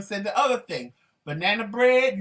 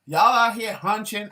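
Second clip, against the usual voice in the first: loud mock quote; 3 semitones higher, 10 dB louder, slower than usual. Quoting someone's funny line.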